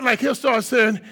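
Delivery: ordinary talk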